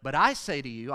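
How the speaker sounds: "I" punched loud; authoritative weight on I; stress on I. Shown as a demonstration. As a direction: hit the word "I" hard. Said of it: "obviously he emphasizes".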